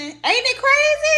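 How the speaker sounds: playful high-pitched mock voice, drawn out; squeaky high voice. Vlogger asking viewers, in a slow squeaky falsetto.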